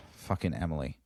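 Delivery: two tired employees sighing in unison, low and soft; weary sigh. Retold or imitated. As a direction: sigh it out, fed up.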